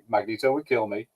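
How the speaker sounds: usual speaking voice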